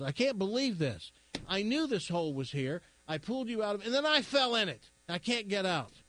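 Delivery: a hint of a fed-up chicken stuck in the hole; exasperated chicken.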